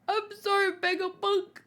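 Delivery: high, pleading little voice; acted tearful apology. Girl apologising to Vegapunk, tearful apology.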